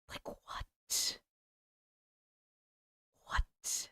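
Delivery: hushed, voiceless whisper; whispered disbelief. Real, not acted. Streamer reacting to a scary video, whispered disbelief. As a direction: whisper it, stunned.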